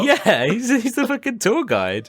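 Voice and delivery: high and laughing. Real, not acted. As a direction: giggle it out. Laughing while telling.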